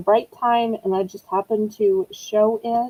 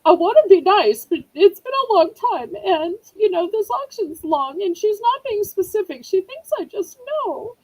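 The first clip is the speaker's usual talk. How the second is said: imitating Rainbow; 9 semitones higher than usual. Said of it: "Rainbow's probably like".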